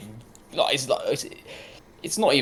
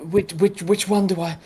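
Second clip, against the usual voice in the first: higher, louder flustered stammer; flustered questioning; 9 semitones higher, 7 dB louder than usual. Acted. Rachel asks Antiveran which one to tend, flustered. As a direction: stumble over the question in a raised voice.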